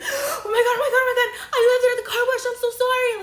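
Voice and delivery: very high, loud, frantic squeal; imitated panic. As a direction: imitate someone squealing apologies in sheer panic. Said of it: "the look of panic".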